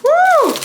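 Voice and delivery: high shrill-free whoop of shock. Real, not acted. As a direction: let out a sudden loud high woo. Smoke smell shocks her.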